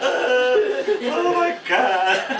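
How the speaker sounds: cracking up in disbelief at the director; laughing disbelief. Retold or imitated. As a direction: stretch it out in helpless laughing disbelief.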